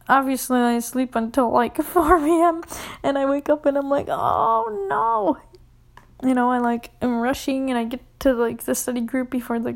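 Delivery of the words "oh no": high dismayed oh no. Retold or imitated. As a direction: realize you overslept, voice jumping high. Waking up late.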